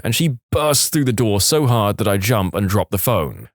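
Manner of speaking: ordinary delivery, nothing marked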